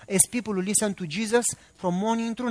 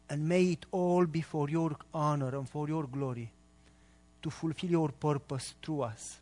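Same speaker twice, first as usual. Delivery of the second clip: prayer; 3 semitones lower, 5 dB quieter than usual. Real, not acted. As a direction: pray softly and unhurriedly, voice sinking toward the amen. Leads an opening prayer.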